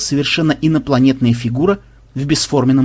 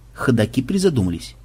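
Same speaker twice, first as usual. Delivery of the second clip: ordinary delivery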